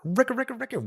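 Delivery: high record-scratch vocal. Pretend. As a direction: scratch it like a turntable. DJ scratch sound effect.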